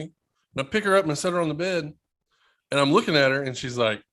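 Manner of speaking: ordinary delivery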